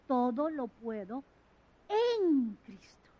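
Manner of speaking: slow, weighty todo lo puedo, en Cristo stretched; slow, stressing en Cristo. Shown as a demonstration. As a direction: linger on en Cristo. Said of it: "no en mis fuerzas".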